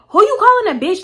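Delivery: high, loud offended comeback; sharp high mimicked outburst; mock indignation. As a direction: imitate her suddenly flipping out. Acted quarrel, Pick Me flares up.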